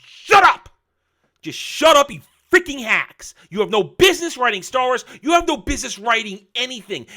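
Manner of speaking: yelling high and loud at the hacks; furious shout. Retold or imitated. Fed-up fan yelling at the show's writers, furious shout. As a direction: blast them, every word a shout. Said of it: "it makes you go".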